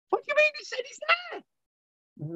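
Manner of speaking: high-pitched exasperated protest. Real, not acted. Lecturer protesting about the missing guest, exasperated. Exasperated at failing video link.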